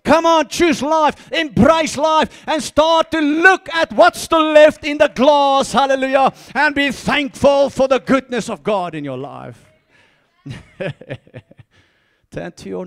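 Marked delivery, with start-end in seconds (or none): 0.1-9.7 rally the church with full force; fervent shouted exhortation. Acted. Preacher urging the congregation to choose life, shouting.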